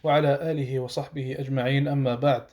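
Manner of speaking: low, fast, hushed recitation; quick, subdued religious preamble; opening invocation. Real, not acted. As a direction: rattle through the formal Arabic opening in a low monotone.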